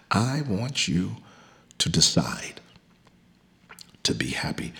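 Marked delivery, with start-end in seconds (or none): 1.8-4.7 a hushed urging whisper. Acted. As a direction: whisper it close to the mic. Preacher whispers the charge.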